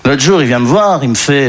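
ordinary talk, nothing marked

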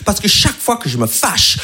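usual speaking voice

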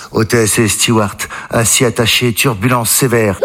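low, slow cabin announcement; mock captain on the intercom, low. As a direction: announce over the plane's speaker. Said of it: "le commandant de bord dit".

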